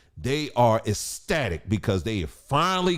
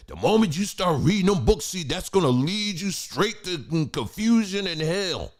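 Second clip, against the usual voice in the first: mock preachy warning; 8 semitones higher than usual. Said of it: "people have said it".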